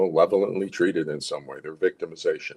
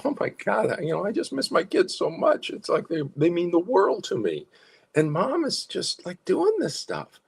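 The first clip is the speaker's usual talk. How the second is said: sad and missing; 11 semitones higher than usual. Shown as a demonstration. Parent telling a psychologist they miss the kids, sad. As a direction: let the loss show as you speak. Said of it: "signal your sadness and loss".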